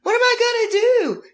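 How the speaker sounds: very high, dramatic mock wail; hammed-up helpless shriek; mock screaming. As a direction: imitate a drama queen in a panic. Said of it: "she was screaming like something".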